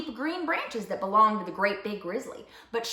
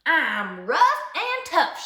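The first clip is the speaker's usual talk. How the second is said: big drawn-out boast, higher and louder; bragging; 6 semitones higher, 6 dB louder, much slower than usual. A narrator's reading. Dusty Locks boasts on the grizzly stump, bragging. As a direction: brag it out slowly and loudly. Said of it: "she bragged".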